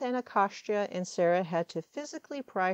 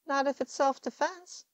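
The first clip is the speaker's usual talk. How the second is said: countering; 10 semitones higher than usual. A narrator's reading. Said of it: "Anacostia countered".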